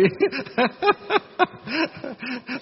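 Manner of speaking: high-pitched run of hitching mock sobs; demonstrated choked weeping. Shown as a demonstration. Monk acting a bit for the elders, choked mock weeping. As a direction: put on bitter, gulping crying. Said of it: "Diễn là tí".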